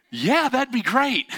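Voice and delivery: high, slow, drawn-out relief; relieved driver. Retold or imitated. Stuck driver finally accepting help, slow high relief. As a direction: stretch out the thanks.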